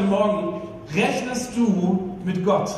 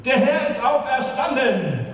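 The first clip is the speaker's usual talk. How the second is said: high-pitched call, falling at the end; Easter proclamation; 5 semitones higher than usual. Real, not acted. Preacher repeating the Easter greeting for the congregation's response, proclaiming. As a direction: call it out to the whole church.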